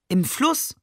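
put-on the child asks in disbelief about the river; astonished. A narrator's reading. Astonished "Im Fluss?" with a rising voice. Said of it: "verblüfft darüber".

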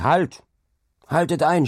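high, urgent stop order; shouted command. A narrator's reading. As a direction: shout down to halt the carts. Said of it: "schrie er zu den Karren hinunter". (gerufener Befehl, hoch und dringlich)

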